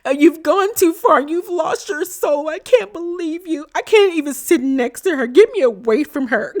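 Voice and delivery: hammed-up wounded tears; mock crying. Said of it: "So Jacqueline starts crying".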